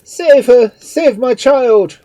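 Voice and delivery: high, very loud frantic plea; desperate drawn-out shout; agony of despair. A narrator's reading. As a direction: scream for help for a child. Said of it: "agony of despair".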